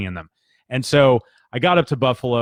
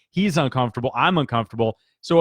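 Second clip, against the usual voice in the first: ordinary talk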